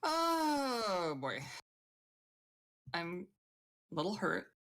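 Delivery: lightly melodramatic wince; dramatic. Acted. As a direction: play up the pain a little. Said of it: "I was just being dramatic".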